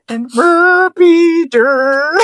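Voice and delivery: slow, high, loud Muppet chef gibberish; hammed-up Swedish Chef sign-off. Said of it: "Swedish from the Muppets".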